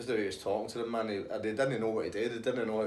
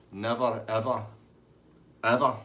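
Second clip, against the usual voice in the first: "never, ever, ever", each word set apart, the last loudest; emphatic; 5 dB louder than usual. Real, not acted. Punchline of mock life lesson.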